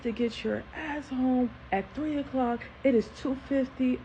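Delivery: high, stern mock scolding; dad's tirade without the full scream; imitating screaming dad. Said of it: "this is screaming. I'm not gonna scream".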